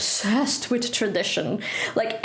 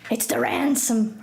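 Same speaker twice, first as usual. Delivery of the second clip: usual speaking voice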